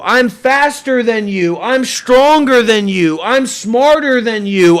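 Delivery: usual speaking voice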